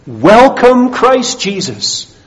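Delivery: loud, high, ringing welcome; Professor Witzius. Retold or imitated. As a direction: proclaim it slowly and boldly, drawing out the name. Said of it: "you start sounding like".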